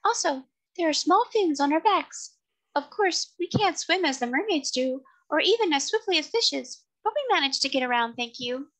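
very high, tiny creature voice; small, distinct, high-pitched voice; tiny seahorse voice. A narrator's reading. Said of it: "in a tiny but distinct voice".

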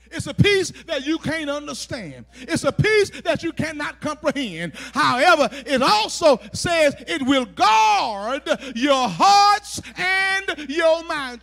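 very loud, emphatic sermon climax; forceful preaching. Acted. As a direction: drive each phrase home to the congregation.

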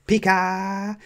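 very high, drawn-out creature cry; high sustained Pikachu squeak. A narrator's reading. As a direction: squeal it like a little creature powering up. Pikachu charging up its attack.

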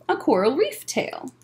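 ordinary delivery, nothing marked

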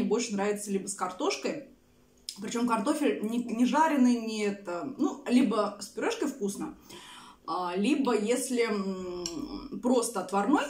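speaking between bites; slightly full mouth; talking while eating. Real, not acted. Seen 1: Fork with food held at mouth while speaking; chewing likely.

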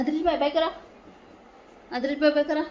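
high, loud sing-out to a little one. Real, not acted. Coaxes child to wave goodbye.

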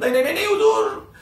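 acted alarmed backpedalling; panicked retraction. A narrator's reading. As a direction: take it back in a comic fluster. Retracts his slip before the inspector.

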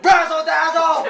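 high, loud battle cry; shouted catchphrase. Pretend. Gamer hyping himself mid-game, shouted catchphrase. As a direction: belt it out with showy gusto.

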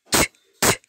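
"T" said as an unvoiced "ch"; two short sneeze-like "ch" sounds for "T, t"; sneezy ch sound. Shown as a demonstration. Teacher imitating C and H sneezing away, sneezy ch. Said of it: "because they're sneezing away".

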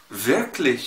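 wirklich بشين خفيفة في الآخر; انطق wirklich بشين خفيفة جدا; light ich. Shown as a demonstration. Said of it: "نطق خفيف خالص". (نطق wirklich بشين خفيفة)

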